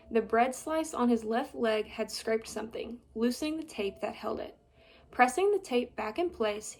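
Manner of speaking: ordinary delivery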